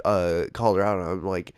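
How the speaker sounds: ordinary delivery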